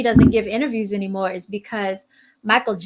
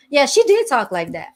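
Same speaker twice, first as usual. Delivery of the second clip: ordinary talk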